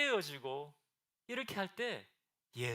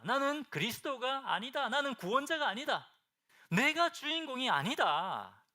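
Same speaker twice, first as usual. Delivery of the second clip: higher, louder emphatic denial; firm declaration; 7 semitones higher, 7 dB louder than usual. Retold or imitated. John the Baptist telling his disciples firmly, loud and emphatic. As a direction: proclaim it like a vow, slowing at the end.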